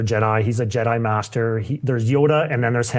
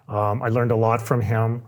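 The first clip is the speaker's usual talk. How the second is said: ordinary delivery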